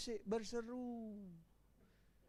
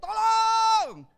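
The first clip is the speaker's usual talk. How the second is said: high, loud shout of Tolong; screaming for help; 11 semitones higher, 10 dB louder than usual. Shown as a demonstration. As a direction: yell it like your bag was snatched. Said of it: "pasti teriak-teriak".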